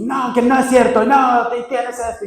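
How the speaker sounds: angry denial. Retold or imitated. Husband angrily denying to his wife. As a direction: argue back hotly. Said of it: "y yo bien enojado".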